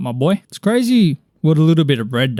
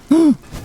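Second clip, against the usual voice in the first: excited gasp; 14 semitones higher than usual. Real, not acted. Spots a blaze rod drop.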